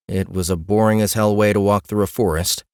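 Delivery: usual speaking voice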